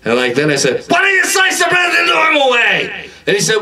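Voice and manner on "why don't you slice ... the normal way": very high, sustained comic yell; shouted complaint. Acted. Customer to Subway worker, exasperated high-pitched shout. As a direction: yell it on one high note, dropping at the end. Same acted outburst at worker.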